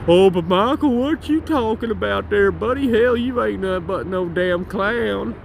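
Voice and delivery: slow, drawn-out heckler voice; slow mocking troll voice. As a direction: taunt yourself like a jeering troll.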